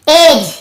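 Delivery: एज said as plain ej, D silent; एज spoken as a model word, no D sound; clear demo. Shown as a demonstration. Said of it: "एज ये पढ़ रहे हैं".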